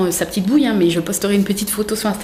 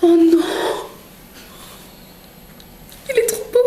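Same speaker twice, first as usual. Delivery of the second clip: moved cooing; 8 semitones higher than usual. Real, not acted. Vlogger opens a baby gift, melting with delight.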